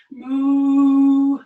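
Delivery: loud, long, steady moo; cow moo. Shown as a demonstration. Teacher shows kids cow pose, loud mooing. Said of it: "This is cow pose".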